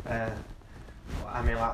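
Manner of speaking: normal talking voice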